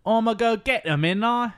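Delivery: put-on high, loud tough-guy Cockney accent; British thug accent. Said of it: "he's like a British Joe Pesci".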